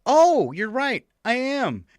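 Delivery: high, slow, drawn-out mock apology; snotty young assistant. Caught still playing music, the assistant concedes, high snotty voice. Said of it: "like a snotty twenty-something girl talking".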